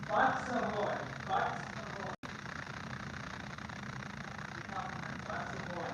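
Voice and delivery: faint, crackly spirit box voice; ghost voice box. Retold or imitated. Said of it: "like the ghost voice box".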